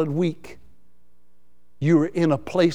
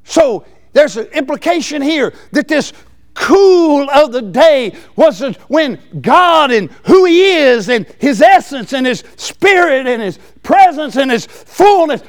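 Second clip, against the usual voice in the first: preaching at full force; 8 semitones higher, 8 dB louder than usual. Acted. Preacher stirring the congregation, loud and high.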